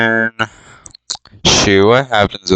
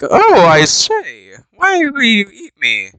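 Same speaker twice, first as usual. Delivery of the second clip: slow, then much higher, fussy outrage; mock posh Bilbo; 9 semitones higher than usual. Bilbo protests to goblins, slow and high and prissy. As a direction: ask it like a prissy little gentleman.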